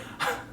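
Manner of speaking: quick unvoiced chuckle; a little huff of laughter; short breathy laugh. Real, not acted. Laughs at own joke.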